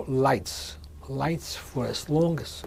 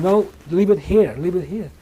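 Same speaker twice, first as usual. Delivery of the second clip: raised, higher voice, imitating a command; retold soldier's order; 5 semitones higher, 9 dB louder than usual. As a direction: order them sharply, as a retold shout. Soldier ordering prisoners to drop belongings.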